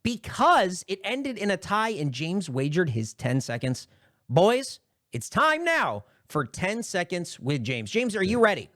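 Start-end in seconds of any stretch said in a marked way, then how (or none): 4.3-8.7 slow, drawn-out host announcement; game show host. Acted.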